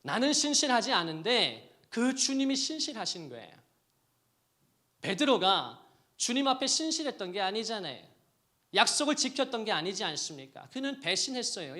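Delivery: loud, high fervent preaching; preaching at full force. Acted. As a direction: drive it home to the congregation. (목청 높여 열정적으로 설교하듯)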